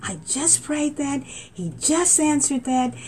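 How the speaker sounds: high, slow astonished exclaim; high amazed retelling; retold amazement. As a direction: gush with wonder, slowly. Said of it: "I'm like".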